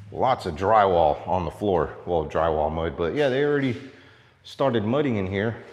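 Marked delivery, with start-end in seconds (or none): none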